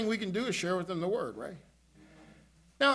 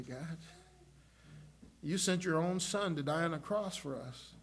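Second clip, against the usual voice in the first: lower, softer repentant prayer; quiet prayer; 5 semitones lower, 5 dB quieter than usual. Retold or imitated. Pastor retells his prayer to the Lord, low and soft. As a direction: pray it low and humble, asking forgiveness.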